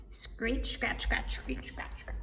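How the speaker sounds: breathy, unvoiced scratch sounds; soft sound-effect scritches; scratching noises. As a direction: rasp out the scratching like claws.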